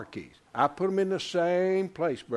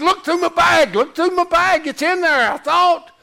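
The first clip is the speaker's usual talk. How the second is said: high, loud, urgent demand; panicked; 11 semitones higher, 8 dB louder than usual. Retold or imitated. Golfer telling two buddies to search his bag, panicked. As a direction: order them to search in a retold panic. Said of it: "I'm panicked".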